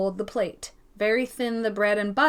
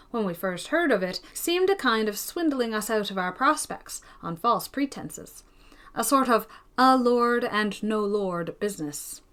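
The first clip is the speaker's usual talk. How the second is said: ordinary delivery, nothing marked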